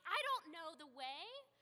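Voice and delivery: high, loud, slow mock panic; mock freaking out. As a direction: act out losing it, lost and anxious. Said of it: "Where I'd be freaking out saying".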